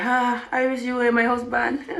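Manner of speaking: soft, slow dreamy longing; quiet swooning aside; mock lovestruck sighing. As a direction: sigh it wistfully, as if smitten. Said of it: "looking at him like".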